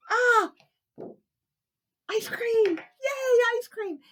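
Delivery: high, loud shriek of delight; excited kid. Acted. Kevin spotting the ice cream truck, high excited squeal.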